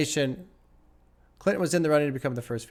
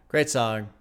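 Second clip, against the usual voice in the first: normal talking voice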